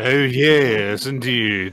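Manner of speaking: loud, high, hammed-up refined voice; mock-distinguished gentleman. Co-host playing along as the collar, mock-distinguished voice. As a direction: exaggerate the pompous gentleman. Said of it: "like, distinguistic voice".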